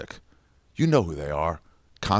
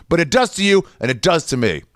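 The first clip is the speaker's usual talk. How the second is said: ordinary talk, nothing marked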